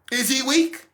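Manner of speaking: a light put-on voice asking is he weak, rising; lightly voiced skeptic questioning a crying man; mock doubting voice. Onlooker mocking a crying man, questioning. Voicing others' doubt, repeated.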